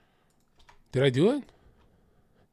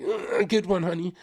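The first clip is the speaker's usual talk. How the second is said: strained, half-hearted approval; imitating a put-upon girlfriend; forced reaction; 3 semitones higher, 3 dB quieter than usual. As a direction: force out polite appreciation of a bad joke. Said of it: "squeeze out of some jokes".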